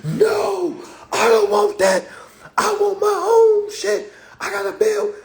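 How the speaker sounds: high, loud whiny mock of folks; hammed-up high whine. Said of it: "we'll be like".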